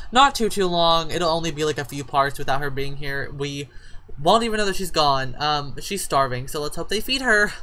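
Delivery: ordinary talk, nothing marked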